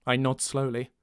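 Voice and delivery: usual speaking voice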